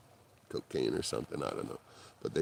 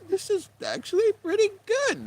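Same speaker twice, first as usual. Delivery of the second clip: surprised. Retold or imitated. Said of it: "they're like".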